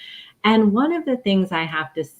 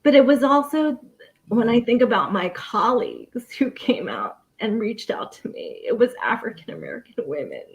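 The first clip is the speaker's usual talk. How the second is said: higher, strained, tearful voice; pushing through rising tears; emotional, near tears; 5 semitones higher than usual. Real, not acted. Panelist recalling colleagues who guided her, tearful. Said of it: "And I'm sorry I'm getting emotional".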